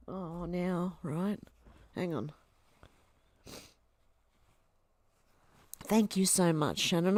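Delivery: soft, choked-up thanks; crying quietly while thanking her; tearful gratitude to a generous viewer; still teary. Real, not acted. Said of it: "The waterworks are on".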